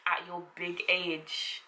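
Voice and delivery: slow, soft disdainful put-down; scornful. Shown as a demonstration. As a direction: drag out "At your big age." with contempt. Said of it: "If they're doing something just dumb".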